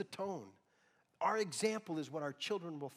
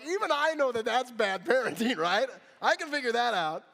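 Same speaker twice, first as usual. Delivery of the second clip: loud, high, laughing through the words; laughing disbelief; 9 semitones higher, 12 dB louder than usual. Real, not acted. Pastor mocking the mom's count, loud laughing disbelief.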